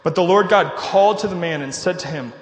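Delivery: loud, projected Bible reading; scripture reading. Acted. Preacher reads Genesis to the congregation, loud public reading. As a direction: read scripture out to the whole room.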